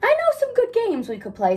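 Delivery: a hint of the playful Cat in the Hat. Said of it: "said the cat".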